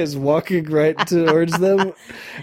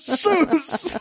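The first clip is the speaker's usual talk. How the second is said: mock fight yelp; 13 semitones higher than usual. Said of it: "Lightning bolt in your fucking face".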